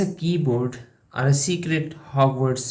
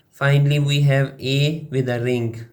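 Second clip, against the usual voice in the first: ordinary talk, nothing marked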